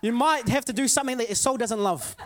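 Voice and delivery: loud, fired-up preaching, half laughing; full-force preaching. Acted.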